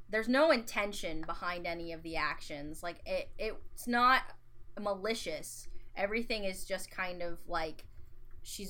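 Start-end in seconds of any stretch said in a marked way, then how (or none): none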